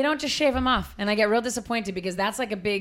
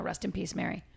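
usual speaking voice

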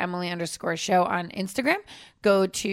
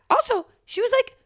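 incredulous; 13 semitones higher than usual. Real, not acted. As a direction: stretch out the shocked disbelief. Incredulous pitch jump.

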